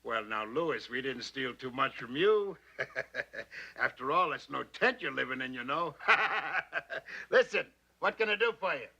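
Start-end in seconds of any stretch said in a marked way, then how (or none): 2.8-3.8 low chuckle at own joke; softer, teasing laugh. Acted. Studio man teases Louis about money, chuckling. 6.0-7.2 rolling laugh at own joke; hearty, teasing guffaw; big laugh. Acted. Studio man teases Louis about money, laughing heartily.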